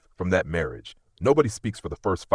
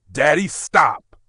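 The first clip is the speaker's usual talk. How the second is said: loud, slow, higher cry of stop; desperate shout; 8 semitones higher, 8 dB louder, slower than usual. Acted. Daughter screaming at her father to stop the fight. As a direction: yell to halt her father's rage.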